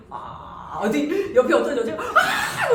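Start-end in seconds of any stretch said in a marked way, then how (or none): 0.1-0.8 voiceless ah breathed into someone's ear; ghost rasp in ear. Pretend. Teacher pranking a stranger in the cinema with a voiceless rasp. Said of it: "귀에다 대고". 2.0-2.7 high, loud shriek, laughing through it; retold scream. Woman in the cinema screaming, retold with a laugh. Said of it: "이렇게 너무 서라운드가".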